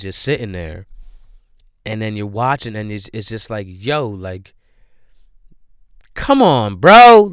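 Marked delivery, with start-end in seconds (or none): 6.1-7.3 loud, much higher "come on, bro!"; exasperated yell. Real, not acted. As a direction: shout at the screen in disbelief. Yelling at the movie character.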